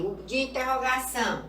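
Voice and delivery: ordinary delivery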